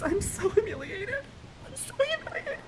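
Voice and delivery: small, high, soft whine; humiliated whimper. Acted. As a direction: squeak it out softly, mortified.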